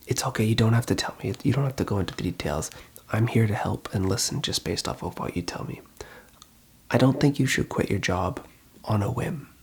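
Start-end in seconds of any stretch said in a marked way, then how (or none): none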